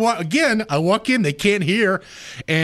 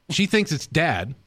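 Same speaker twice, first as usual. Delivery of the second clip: usual speaking voice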